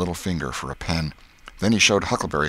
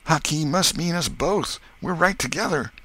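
put-on high, alarmed boy; frightened realisation; 12 semitones higher than usual. Tom tells Huck both are doomed, frightened. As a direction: break it in a high, fearful voice.

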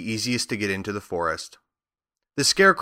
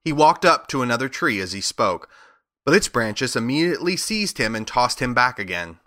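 ordinary delivery

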